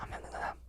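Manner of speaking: whispered om nom chomp; chomping noise. Retold or imitated. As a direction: mimic chomper toys eating. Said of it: "that's why I made that noise".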